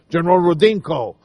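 deep Russian general voice; Russian accent. Shown as a demonstration. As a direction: say it like a Bond villain general. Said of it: "just sounds like a Russian general".

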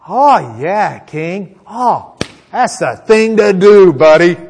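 loud, drawn-out mock agreement; very sarcastic. Micaiah answers the king about battle, very sarcastic. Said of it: "very sarcastically".

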